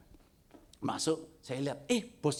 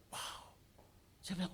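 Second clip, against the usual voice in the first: normal talking voice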